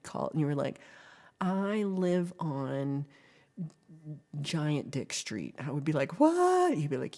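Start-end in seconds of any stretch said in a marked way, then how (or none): 1.4-5.6 slow, drawn-out pretend confession; mock announcement. Imagined caller giving an absurd street name, slow mock announcement. 6.2-6.8 high, loud, drawn-out mock disbelief; mock shocked. Streamer reacts to imagined caller's address, mock shocked.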